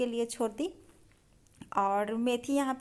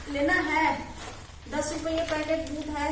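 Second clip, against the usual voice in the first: vegetable seller voice; 5 semitones higher than usual. Pretend. Playing vendor to husband.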